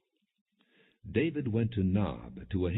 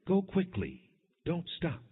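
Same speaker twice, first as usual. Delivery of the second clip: urgent calls pitched much higher; shouting; 10 semitones higher than usual. A narrator's reading. Jonathan urging the running boy on, shouted high. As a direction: shout after him to hurry. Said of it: "Then he shouted".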